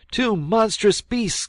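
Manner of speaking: high, loud, drawn-out cry of alarm; piercing boyish alarm call. A narrator's reading. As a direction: shout a terrified warning. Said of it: "cried he".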